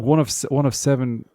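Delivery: usual speaking voice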